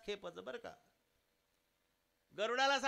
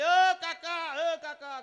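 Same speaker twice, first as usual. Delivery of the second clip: calling out; 7 semitones higher, 10 dB louder, much slower than usual. Acted. Villagers calling out to uncle.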